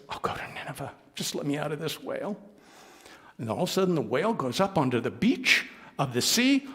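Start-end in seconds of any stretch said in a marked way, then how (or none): 0.1-2.4 soft, whispered surrender; whispered plea. Acted. Jonah inside the whale whispering his promise to go. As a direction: beg to be let out, hushed.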